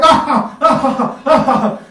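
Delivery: high mock guffaw; exaggerated American laugh. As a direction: laugh far too hard at nothing. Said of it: "they exaggerate".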